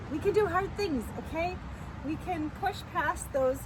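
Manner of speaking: bright encouraging lift; rally yourself with a cheer; upbeat pep talk. Real, not acted.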